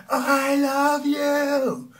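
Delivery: drawn-out, loud, high scream. Shown as a demonstration. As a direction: belt out I love you slowly at the top of your voice. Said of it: "if I would scream out the word".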